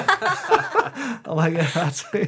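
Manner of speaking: high, laughter breaking through words; laughing retelling. Real, not acted. Storyteller admits to being sweet buns guy, laughing. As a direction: laugh helplessly while admitting it. Laughing at his embarrassing nickname.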